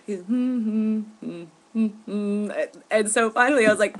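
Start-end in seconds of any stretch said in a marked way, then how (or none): 0.2-2.5 idle humming while on hold; mock humming. Mocking the congressman humming while on hold. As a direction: hum tunelessly like someone waiting on hold. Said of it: "on the phone humming".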